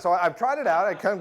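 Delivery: usual speaking voice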